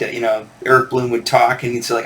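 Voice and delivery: ordinary talk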